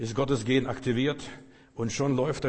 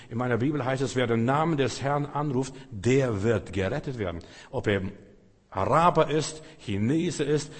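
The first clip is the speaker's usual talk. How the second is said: ordinary delivery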